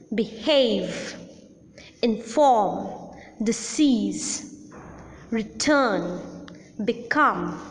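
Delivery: beHAVE, inFORM, disEASE, reTURN, beCOME; root-syllable stress. Shown as a demonstration. Teacher shows root rule with behave, inform, disease, return, become, stressed on the second syllable. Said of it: "accent generally falls on the root syllable".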